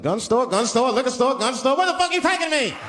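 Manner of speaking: hammed-up rising panic; mounting alarm. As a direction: build from nervous listing to high outcry. Alarmed passenger reading shop signs.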